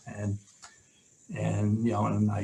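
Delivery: ordinary talk, nothing marked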